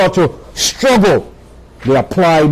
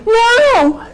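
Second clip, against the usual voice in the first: emphatic no. Retold or imitated. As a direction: bark the no way up high. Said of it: "she put the emphasis".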